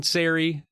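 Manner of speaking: Sari said SAIR-ee; first pronunciation option. Shown as a demonstration. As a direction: say Sari to rhyme with "fairy". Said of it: "Is it Sari or Sari?".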